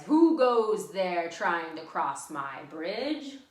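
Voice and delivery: loud, slow, menacing troll challenge; booming troll. Acted. Troll challenging the goat on his bridge, slow loud booming. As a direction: bellow it slowly like a troll guarding his bridge. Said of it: "and he says".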